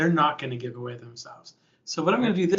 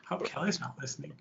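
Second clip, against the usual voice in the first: usual speaking voice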